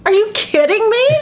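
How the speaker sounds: high, loud disbelief; are you kidding me, half laughing; shocked and amused; incredulous laugh. Retold or imitated. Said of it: "I literally started laughing".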